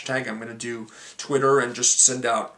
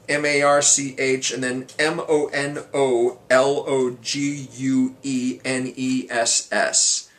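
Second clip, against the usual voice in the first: slow, deliberate letter-by-letter delivery; spelling it out; slower than usual. Real, not acted. Vlogger giving viewers the new hashtag, spelled out slowly. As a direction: spell it out slowly, then say the name slowly.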